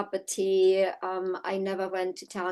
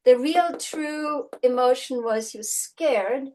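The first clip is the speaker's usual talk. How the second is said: normal talking voice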